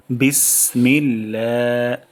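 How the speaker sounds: drawn-out, slow বিসমিল্লাহ recited carefully; careful tajweed demo; slow Quranic recitation. Shown as a demonstration. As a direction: stretch বিসমিল্লাহ syllable by syllable. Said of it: "এখানে বিস সিন থেকে আমরা বাতাস বের করব".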